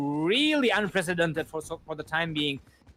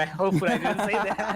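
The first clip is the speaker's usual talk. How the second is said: laughing; slower than usual. Real, not acted. Caster laughs at own slip.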